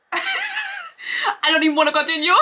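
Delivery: laughing. Real, not acted. Laughing at own mispronunciation.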